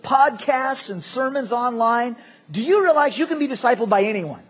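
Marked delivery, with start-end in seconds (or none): none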